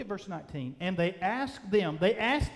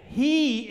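He higher, louder and drawn out; stress on HE; 4 semitones higher, 5 dB louder than usual. Shown as a demonstration. Preacher showing the verse's emphasis, stress on HE. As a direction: hit He hard as its own word.

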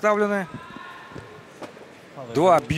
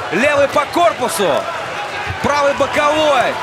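fast, excited play-by-play; live boxing commentary; 8 semitones higher than usual. Real, not acted. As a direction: call each punch as it lands.

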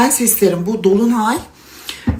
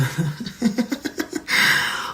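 breathy laugh; 3 semitones higher, 7 dB quieter than usual. Real, not acted. Laughing about dancing halay.

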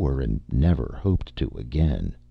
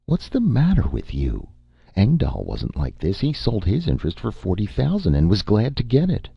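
higher, impatient woman's voice; young woman losing patience over the deal, higher pitched; Amy, exasperated; 8 semitones higher than usual. Acted. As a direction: scold him for being difficult.

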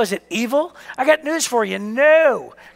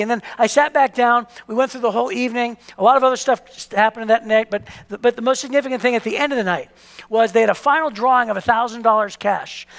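usual speaking voice

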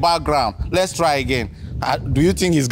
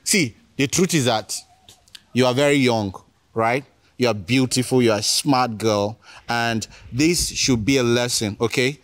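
gentle advice; 6 semitones lower than usual. Real, not acted. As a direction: talk her through it kindly. Host counsels young caller gently.

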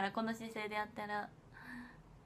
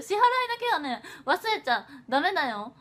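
mock scolding; 4 semitones higher, 8 dB louder than usual. Playful scolding reminder.

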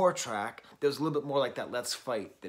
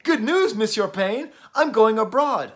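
much higher and louder, gushing with news; excited student; 9 semitones higher, 12 dB louder than usual. Retold or imitated. French professor imitating his student announcing study abroad, excited and loud. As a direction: burst out with the big news like a thrilled student. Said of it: "And then they're like".

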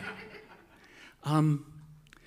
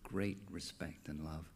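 soft reverent quote; 9 semitones lower, 14 dB quieter than usual. Retold or imitated. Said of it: "she always used to start".

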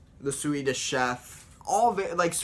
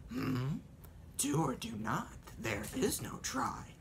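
soft breathy sage voice; whispered wise creature; Yoda voice; 10 dB quieter than usual. Shown as a demonstration. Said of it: "it's all about the way you talk".

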